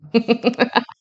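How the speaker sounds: bright real laugh; burst of laughter. Real, not acted. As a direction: laugh out loud at the story. Laughs after reading the article.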